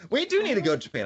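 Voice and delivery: eager, high and loud; excited agreement. Real, not acted. Friend eagerly agreeing to the Japan trip, excited. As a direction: jump in all fired up.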